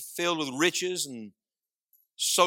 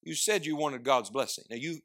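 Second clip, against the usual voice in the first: normal talking voice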